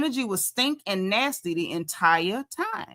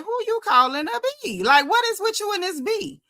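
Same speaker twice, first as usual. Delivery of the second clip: much higher, indignant group question; high outraged disbelief; 9 semitones higher than usual. Retold or imitated. Everybody reacts to insult.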